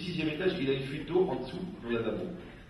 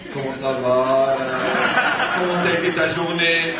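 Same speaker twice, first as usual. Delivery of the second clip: mock sociable chat; 8 dB louder than usual. Strangers chatting in a shared public toilet, mock sociable. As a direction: greet your neighbor on the next seat.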